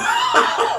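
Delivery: a sudden high squeal of laughter; high laugh burst. Real, not acted. Laughing at the suit story.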